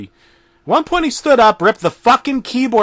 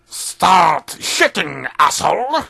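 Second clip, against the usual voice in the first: slow, gruff, put-on low voice; deep Eggman voice; slower than usual. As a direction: growl the order like a villain. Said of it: "Eggman said in a deep, uncharacteristic voice".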